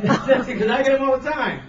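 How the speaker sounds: high, laughing reply from the patient in the bed. Retold or imitated. Patient in the bed laughs off the needle stick, laughing. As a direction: chuckle it out as the amused patient.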